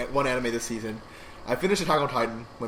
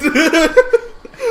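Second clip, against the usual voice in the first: loud high laugh; 10 dB louder than usual. Real, not acted. Laughs at vanished rash.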